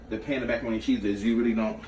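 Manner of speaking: ordinary talk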